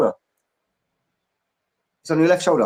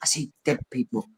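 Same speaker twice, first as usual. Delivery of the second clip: movie quote whisper; 8 dB quieter than usual. Pretend. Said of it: "whispered to a dog".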